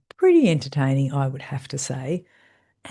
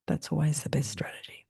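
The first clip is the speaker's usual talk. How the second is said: whispered aside; 8 dB quieter than usual. Real, not acted. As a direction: drop to a conspiratorial whisper. Confiding aside to viewers.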